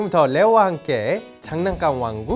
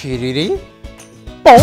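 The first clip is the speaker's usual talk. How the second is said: magic spell; 5 semitones lower, 6 dB louder than usual. Pretend. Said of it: "마법사니까 주문을 통해".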